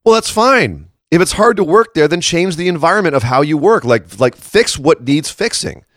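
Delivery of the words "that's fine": ordinary talk, nothing marked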